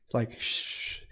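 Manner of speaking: soft unvoiced shush. Retelling the whispering outside, a soft shush. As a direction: hush like a whispering intruder.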